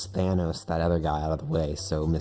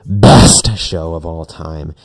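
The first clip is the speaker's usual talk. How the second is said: slow, very loud outburst for her favorite show; shouted defense; 16 dB louder, slower than usual. Acted. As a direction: bellow it out, dragging each word. Acted quarrel, defending favorite show.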